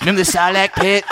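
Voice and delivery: Cartman voice. Retold or imitated. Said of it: "Why am I doing it in the Cartman voice?".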